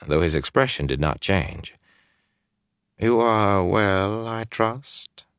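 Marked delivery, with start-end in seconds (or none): 3.0-5.2 slow, overly courteous question; a lord's slow, slightly too polite inquiry. Acted. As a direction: ask with oily, overdone courtesy. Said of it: "Perhaps too politely".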